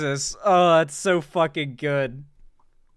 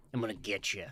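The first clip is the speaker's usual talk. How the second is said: lower, much softer creaking fry; deep vocal fry, nearly silent; fried whisper threat; 8 semitones lower, 14 dB quieter than usual. Pretend. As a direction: breathe it out like a ghost. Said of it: "weird-ass noises".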